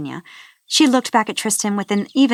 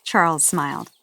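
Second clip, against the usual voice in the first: ordinary delivery, nothing marked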